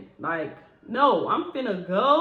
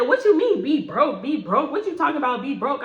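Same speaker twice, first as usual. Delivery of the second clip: higher, louder outraged disbelief; indignant coworker; 5 semitones higher, 5 dB louder than usual. Retold or imitated. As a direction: play her shocked and offended, pitched up. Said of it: "she said".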